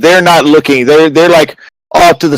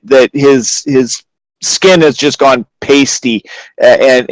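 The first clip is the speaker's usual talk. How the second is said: normal talking voice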